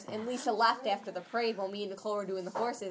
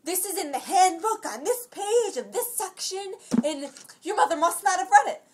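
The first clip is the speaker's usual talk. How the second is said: high, loud mock lecture; retold yelling; 9 semitones higher, 8 dB louder than usual. Imitating the lady citing the handbook, high mock yelling. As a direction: imitate an outraged rule-quoter, pitched up and emphatic. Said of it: "She's yelling".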